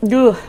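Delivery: sharp loud ugh; fed-up ugh. Real, not acted. Vlogger giving up on the sentence with a groan. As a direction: grunt out your frustration.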